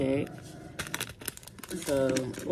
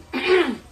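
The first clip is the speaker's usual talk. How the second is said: throat clear; 10 semitones higher, 5 dB louder than usual. Real, not acted.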